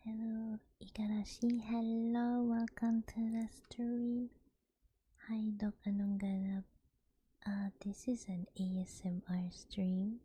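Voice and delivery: whispered stream welcome; ASMR whisper. Acted. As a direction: greet viewers in a hushed whisper.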